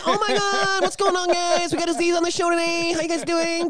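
high excited host voice; Aziz impression. Imitating Aziz greeting the crowd, high excited. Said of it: "I watched some Aziz Ansari".

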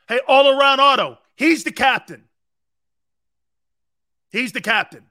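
high, loud excited shout; loud hype shout. Real, not acted. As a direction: bellow it like a cheering fan.

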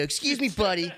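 high-pitched cheeky insult; playful, hammed-up brush-off; mock defiance. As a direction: act out gleefully telling off a cop. Acts out insulting a cop.

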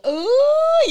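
high loud whooping ooh; excited ooh. Real, not acted. Lady K whooping at the cheering crowd, high and loud.

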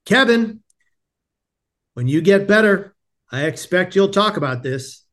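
imitated friar, high loud name, then easing; calling out. Chaplain to departing patient on the last day, calling out. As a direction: hail him by name, then deliver measured advice.

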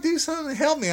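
very high mock-hysterical begging; desperate plea. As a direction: imitate a woman frantically begging for help. Acted desperate woman begging for help.

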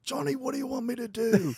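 high-pitched imitation of a bodyguard shouting; urgent acted call. Bodyguard gripping an attacker calls to friend, high urgent shout.

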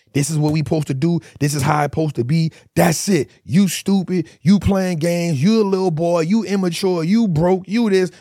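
imitated online tirade; mock scolding. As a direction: act out berating someone, pounding each insult. Acting out people berating each other.

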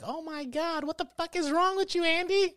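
horrified foster mom. Retold or imitated. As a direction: shriek it like a horrified mother. Foster mom screaming at Andy.

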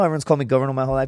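ordinary talk

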